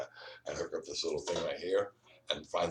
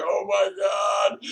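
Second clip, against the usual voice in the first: drawn-out, much higher and louder shout of wonder; amazed exclamation; 11 dB louder, much slower than usual. Retold or imitated. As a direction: cry out slowly in sheer amazement. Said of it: "and I was like".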